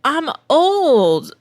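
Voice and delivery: loud, high comic outburst; exasperated protest. Acted. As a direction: protest it with a big swoop on OLD. Mock protest at pop song request.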